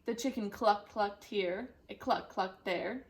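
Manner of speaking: ordinary delivery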